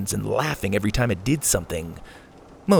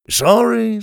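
high, loud sorry called after them; called-out apology; 12 semitones higher, 5 dB louder than usual. Acted. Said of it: "he called after them".

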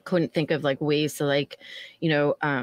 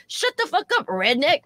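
much higher, squealed insult; mock screech; 8 semitones higher than usual. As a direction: ape the drunk woman's outburst. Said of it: "And she goes".

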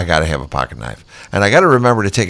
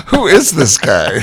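laughing amazement; 8 semitones higher, 4 dB louder, slower than usual. Acted.

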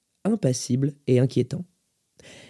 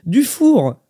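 "Dufour" loud and high, then falling; "Dufour" insisted, much higher and louder; interrupting correction; 9 semitones higher, 6 dB louder than usual. A narrator's reading. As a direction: cut in sharply with "Dufour". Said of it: "l'interrompit-elle".

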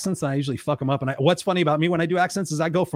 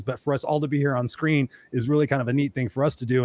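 normal talking voice

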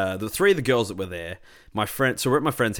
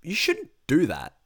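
slow and high, each word spelled out to her; slow, high telling-off; 7 semitones higher, slower than usual. Retold or imitated. Retold scolding of the rude girlfriend, slow and raised. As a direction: lay it down slowly, pitched up. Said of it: "I said to her, I'm like".

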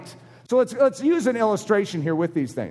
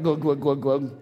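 low, lazy glug glug of someone gulping booze; glugging noise; 8 semitones lower than usual. Retold or imitated. Preacher mimics the lamb drowning itself in alcohol, glugging.

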